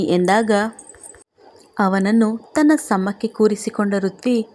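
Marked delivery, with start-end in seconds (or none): none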